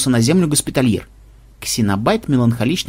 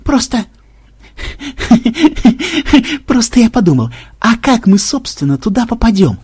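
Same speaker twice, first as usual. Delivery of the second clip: high giggling voice breaking into a laugh; through laughter; 8 semitones higher than usual. A narrator's reading. Programmer asks the team how they'd get up, through laughter. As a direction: struggle to speak through a fit of hysterical giggles. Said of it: "выдавил сквозь смех Ксенобайт".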